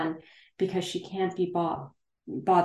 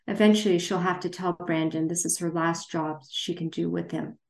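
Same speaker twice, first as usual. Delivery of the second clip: usual speaking voice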